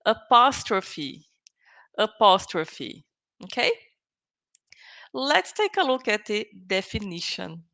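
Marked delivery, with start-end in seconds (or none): 0.1-3.0 "apostrophe" slow, stress on POS; apostrophe for repeating. Shown as a demonstration. Teacher asks students to repeat after her, slowly, stressing POS. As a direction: model "apostrophe" slowly for a class to echo. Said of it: "repeat with me".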